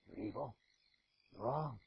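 low, quiet verdict; cold condemnation. Acted. The law quietly condemning the sinner. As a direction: pronounce judgment coldly.

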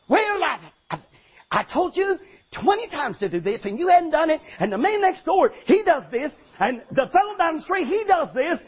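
hammed-up nagging wife comparing him to the neighbors. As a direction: scold him like a wife who keeps count.

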